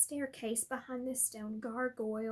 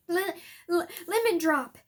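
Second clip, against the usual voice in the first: stammering, out of breath, loud at the end; panting; 4 semitones higher, 8 dB louder than usual. Acted. Harry to the gargoyle, panting and loud. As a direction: gasp the password after running. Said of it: "he panted at it".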